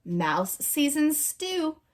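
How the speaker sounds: ordinary delivery, nothing marked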